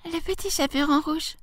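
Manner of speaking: put-on high, soft little-girl voice. A narrator's reading. Girl answers at the door.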